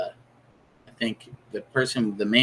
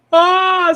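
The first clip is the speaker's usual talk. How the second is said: high, loud shriek of terror; sudden scream; 10 dB louder than usual. Pretend. Stage hypnosis subject shrieks in sudden mock terror. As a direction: let out a piercing mock scream.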